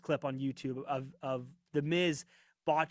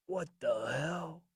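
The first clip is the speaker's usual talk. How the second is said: laughing impression; 4 dB quieter, slower than usual. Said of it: "Let me do my best impression".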